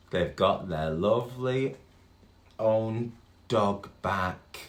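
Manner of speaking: ordinary delivery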